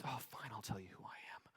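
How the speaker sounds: a quick, grudging whisper. Retold or imitated. John grudgingly whispers his answer to the questioners. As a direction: mutter it like giving in at last. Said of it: "And he goes".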